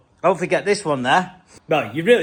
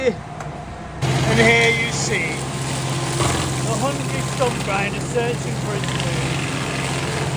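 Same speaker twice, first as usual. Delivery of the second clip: slow, higher, hushed nature-film narration; mock wildlife documentary; 6 semitones higher, much slower than usual. Vlogger narrating the stump grinder like a nature documentary. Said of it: "nature documentary parody".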